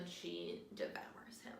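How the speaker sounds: whispered horror narration; soft, spooky whisper; creepy whisper. Acted. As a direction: hush it like a campfire story. Narrating the gruesome kill.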